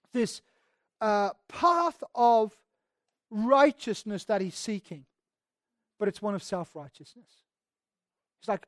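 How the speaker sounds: ordinary talk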